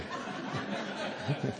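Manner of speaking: a short unvoiced chuckle; real quiet laugh after the punchline; breathy laugh. Real, not acted. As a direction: laugh softly at his own joke. Laughing at his own punchline.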